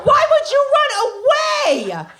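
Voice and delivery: high, loud, slow yell after him; mock yell. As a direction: shout it in disbelief. Yelling after fleeing friend.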